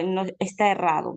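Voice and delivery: ordinary delivery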